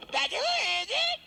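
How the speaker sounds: high, loud, drawn-out yell; furious slow shout. Acted. Plumber banging on the door yells slow and high. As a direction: drag out the yell in fury.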